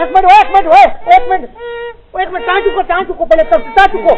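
loud, high, repeated alarm; urgent calling out. Acted. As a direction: holler at them to stop and lift. Urgently halts others to lift something. (ਘਬਰਾ ਕੇ ਉੱਚੀ ਹਾਕ)

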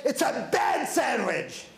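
slowed, barked mock shout; rude complaint. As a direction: spit out the verdict like a bully. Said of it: "just obnoxious and rude".

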